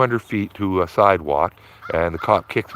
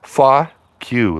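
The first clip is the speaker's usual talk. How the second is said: mock telling off; 5 semitones higher, 4 dB louder than usual. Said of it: "I go".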